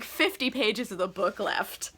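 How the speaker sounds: ordinary talk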